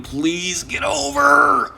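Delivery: high, slow plea to the ball; pleading. Real, not acted. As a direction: beg the ball to clear the creek. Begging ball to clear creek.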